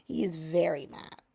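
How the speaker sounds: ordinary delivery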